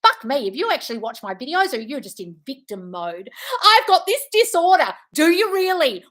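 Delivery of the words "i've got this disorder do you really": high, loud sarcastic jab; mocking sarcasm. Real, not acted. Host mocking a viewer's disorder claim, sarcastic jab. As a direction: sneer the claim back. Mocking commenter then retort.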